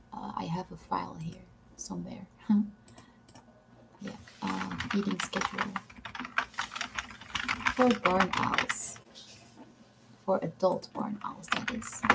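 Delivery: whispered reading to herself; barely audible whisper, reading notes aloud. Real, not acted. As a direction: whisper it while searching a file. Reading her file half aloud.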